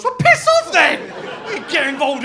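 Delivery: high, loud laughing dismissal; mock angry retort. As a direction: tell her off half-laughing.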